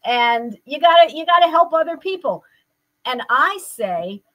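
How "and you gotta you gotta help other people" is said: louder, higher eager grandchild; grandson's voice. Retold or imitated. Grandson urging grandma to help others, eager and louder. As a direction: press her like an excited kid. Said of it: "And he'll say".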